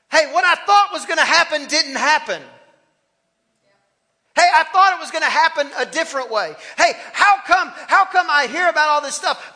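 high, loud preaching voice, pressing each question; full-force preaching. Acted. Preacher voicing the frustrated believer to the church, loud preaching. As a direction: preach it out loud to the back row.

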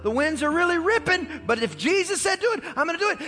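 high, hurried self-persuasion; talking himself into it. Acted. Peter hesitates before stepping out, talking himself into it. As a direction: talk yourself into it, pitch climbing. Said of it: "He's trying to talk his way into it".

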